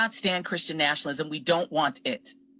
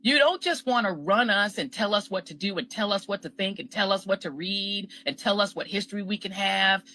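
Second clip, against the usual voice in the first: normal talking voice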